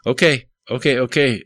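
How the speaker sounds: a hacker snapping back to reality, stammering; stuttering. A narrator's reading. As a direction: Kyle stammers as his brain leaves hacker mode. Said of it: "stuttered Kyle".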